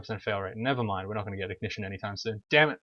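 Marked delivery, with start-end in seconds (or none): 2.5-2.8 annoyed loud curse; frustrated curse. Real, not acted. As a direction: curse the bad luck. Skill fails in game.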